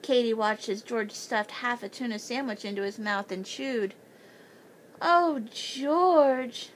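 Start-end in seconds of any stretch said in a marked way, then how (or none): 5.0-6.7 moaning. A narrator's reading. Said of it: "Mandy Banks moaned".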